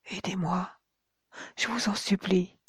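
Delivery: a ghostly voice begging from far off; whispered plea from the unseen woman; pleading murmur. A narrator's reading. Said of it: "des murmures".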